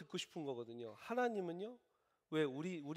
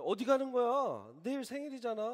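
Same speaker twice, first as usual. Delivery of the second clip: startled call; 10 semitones higher, 8 dB louder than usual. Pretend. Husband calling after his wife rushing out, startled. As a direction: call after her in surprise.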